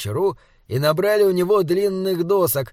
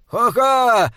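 triumphant ha-ha, higher and louder; gleeful laugh; 8 semitones higher, 5 dB louder than usual. A narrator's reading. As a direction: gloat with a laugh. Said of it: "радостно захохотал Джеремия". (радостный хохот)

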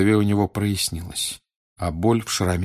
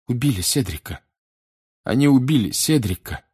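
put-on higher, anguished boy; distraught; 5 semitones higher than usual. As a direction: grieve as you force the words out. Boy reports friend's death. (с горем и надрывом)

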